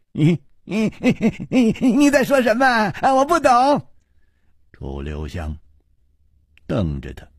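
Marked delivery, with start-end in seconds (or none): 0.1-3.9 high, silly madman voice; childish madman. Acted. Xue playing mad with Chu, high childish voice. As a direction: play the giddy fool.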